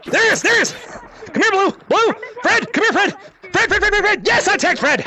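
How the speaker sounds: high, frantic calling out; excited chase. Real, not acted. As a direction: chase them down in a high, urgent voice. Spots and tags Fred.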